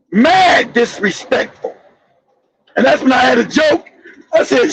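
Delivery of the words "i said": ordinary delivery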